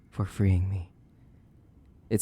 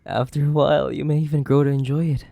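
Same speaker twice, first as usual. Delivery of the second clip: higher, louder, fast and gleeful; loud, fast, higher gloating; gleeful taunt; 6 semitones higher, 11 dB louder, faster than usual. Acted. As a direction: crow it at the captive.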